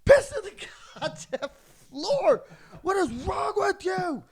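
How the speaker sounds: high, loud, scolding accusation; outraged yelling. Retold or imitated. Housemate accusing him over the floor, outraged acted shout. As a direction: yell it in exasperated disbelief.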